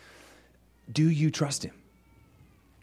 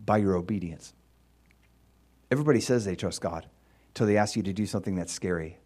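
ordinary talk, nothing marked

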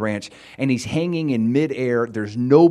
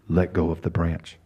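lower, calm command from God; God's voice; 6 semitones lower than usual. Retold or imitated. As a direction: say it low and steady. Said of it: "And God says".

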